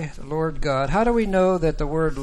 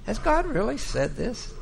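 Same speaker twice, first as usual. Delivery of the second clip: lightly voiced sceptical question, pitched higher; casting doubt; 6 semitones higher than usual. Satan questioning what God said, mildly doubting. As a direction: raise the pitch in mild disbelief. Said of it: "Satan always casts this doubt".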